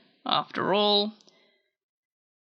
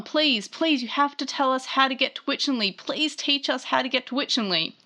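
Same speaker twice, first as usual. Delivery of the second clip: higher, hurried mock begging; pleading; 5 semitones higher than usual. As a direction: beg eagerly as a retold character. Retold pleading for directions.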